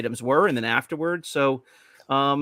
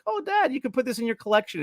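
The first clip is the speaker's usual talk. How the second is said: put-on cute kid voice; 9 semitones higher than usual. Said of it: "very cutely when they were little said".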